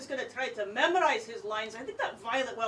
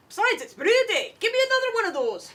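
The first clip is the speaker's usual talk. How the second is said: higher, fast and pushy for another; tipsy dwarf lass wanting more ale; eager dwarf lass; 9 semitones higher, 3 dB louder than usual. Acted. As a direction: demand another mug, merrily. Dwarf demanding more beer.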